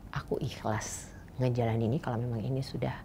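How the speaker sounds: a touch of whispered devotion; hushed prayer. Retold or imitated. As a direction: murmur it like a quiet prayer. Quoting a surrendering prayer.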